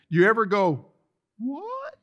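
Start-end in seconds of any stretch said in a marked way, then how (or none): 1.4-1.9 squeaky high-pitched baffled what; mother-in-law's high what. Retold or imitated. Preacher imitating his mother-in-law's startled high what. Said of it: "I say that like that 'cause that's how my mother-in-law used to say it".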